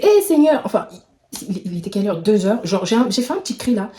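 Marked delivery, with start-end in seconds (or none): 0.0-0.6 mock cry. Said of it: "j'ai crié".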